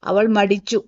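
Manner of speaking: ordinary delivery, nothing marked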